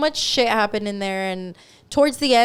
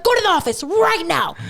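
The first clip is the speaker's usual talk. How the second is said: higher, louder put-on command; bossy manager voice; 8 semitones higher, 4 dB louder than usual. Manager ordering her employee to the office, stern. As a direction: bark it like a stern boss.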